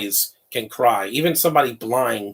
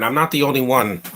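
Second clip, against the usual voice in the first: ordinary talk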